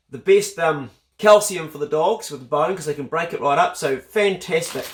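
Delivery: ordinary talk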